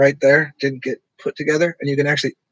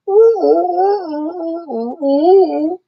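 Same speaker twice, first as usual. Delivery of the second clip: high hooting ooh sounds, much higher; high ooh calls; 4 dB louder, slower than usual. Retold or imitated. Imitating people in the background hooting high oohs. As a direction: mimic the background crowd hooting. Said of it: "everybody else in the background just going".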